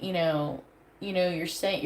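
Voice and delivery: usual speaking voice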